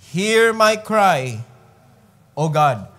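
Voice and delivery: Hear my cry drawn out slow and loud, Hear stressed; slow emphatic repeat. Shown as a demonstration. Preacher to congregation, slow emphatic plea. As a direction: linger on Hear my cry, press each word. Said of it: "repeated slowly for emphasis".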